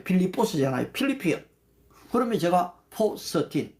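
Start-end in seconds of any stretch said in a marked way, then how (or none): 0.9-1.4 필리피언 said high and clear, the English way; Philippians said in English. Shown as a demonstration. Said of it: "또 필리피언 해보세요".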